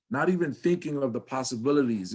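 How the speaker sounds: ordinary delivery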